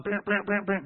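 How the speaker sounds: high, repeated buzzer noise; imitated call-button buzz. Son mashing the nurse button, imitated buzzing. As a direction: mimic a buzzer going off repeatedly.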